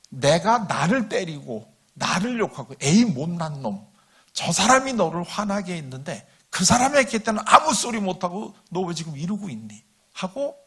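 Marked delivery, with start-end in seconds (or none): 2.8-9.8 loud, emphatic telling-off of oneself; acted exasperated scolding, easing off at the end; self-scolding. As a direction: berate yourself like someone who has let you down. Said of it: "자기를 야단치고".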